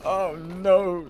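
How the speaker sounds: high, drawn-out mock wail; hammed-up horror at bad memories; mock dread. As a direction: wail it in pretend despair.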